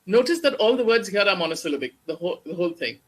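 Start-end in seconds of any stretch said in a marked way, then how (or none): none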